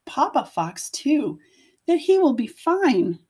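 put-on gentle read-aloud voice for young children; storybook narration. A narrator's reading. Teacher reading a picture book to her class, gentle storytelling. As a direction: tell it like a bedtime picture book.

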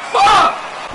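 a yelled curse whose voice cracks high; voice cracking. Real, not acted. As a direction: let the voice crack on the yell. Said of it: "My voice just cracked".

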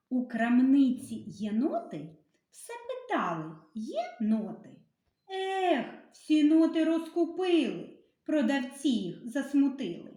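lively sing-song rhyme reading; poem for children. Acted. Teacher reading a rhyme to children, sing-song. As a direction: read the rhyme brightly to the kids.

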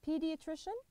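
Pediatrician said slowly, rising at the end; careful model word. Shown as a demonstration. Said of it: "الطبيب حق الأطفال".

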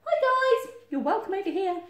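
high, loud welcome; friendly calling. Acted. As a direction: call over brightly to friends. Friendly animals call over wall.

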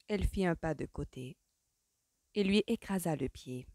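usual speaking voice

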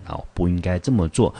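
normal talking voice

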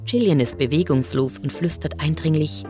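ordinary delivery

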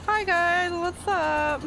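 vlogger voice. Pretend. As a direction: ham up a vlog intro. Mock vlogger greeting.

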